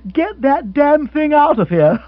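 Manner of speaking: high, loud hammed-up bellow of an old lord; mock shout. Rory imitating the old lord shouting at the lover. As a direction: bellow like an outraged old father.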